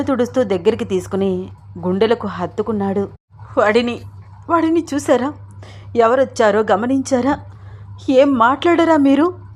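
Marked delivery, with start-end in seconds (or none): 3.6-9.4 higher-pitched anguished questioning; acted distress, appealing for a response; deeply distressed. A narrator's reading. Wife appeals to husband about Ram, distressed. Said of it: "చాలా బాధపడుతూ అంది".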